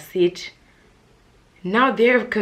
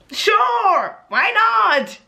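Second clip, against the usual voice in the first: much higher, loud tipsy yell; excited shout; 7 dB louder, much slower than usual. Retold or imitated. Agreeing to stay at the party, loud excited shout. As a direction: belt it out, game for anything.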